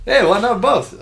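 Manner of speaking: high, loud put-on Spanish accent; Dora Spanish accent. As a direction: chirp it in a cartoonish Spanish accent. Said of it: "that's my Spanish accent".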